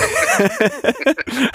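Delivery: sudden high giggle; bright burst of laughter. Real, not acted. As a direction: laugh it off, embarrassed. Embarrassed after forgetting his words.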